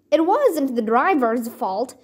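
plain reading voice

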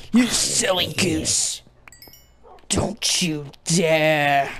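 very slow, drawn-out playful threat; mock warning. Player warning animal not to attack, slow mock threat. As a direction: stretch each word out menacingly, in jest.

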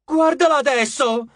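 high, loud accusation; disgusted outrage. A narrator's reading. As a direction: cry out in indignant disgust. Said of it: "Con aria disgustata". (sdegno gridato)

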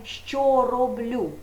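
що stressed, very slow що роблю; slow question. Shown as a demonstration. Teacher stressing the present tense question for pupils, slowly. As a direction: draw out що роблю. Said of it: "відповідає на питання".

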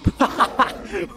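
high burst of laughter; real laugh. Real, not acted. Laughs at surprise in game.

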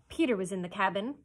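usual speaking voice

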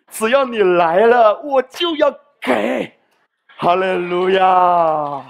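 loud, drawn-out hallelujah climax; preaching at full force. Acted. Preacher proclaiming the Father's eagerness to give. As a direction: build to a ringing hallelujah.

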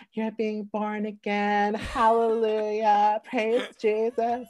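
high, drawn-out hallelujah voice; mock-ecstatic friend. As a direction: imitate a rejoicing believer, slow and high. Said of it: "And she's like".

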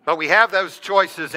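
ordinary talk, nothing marked

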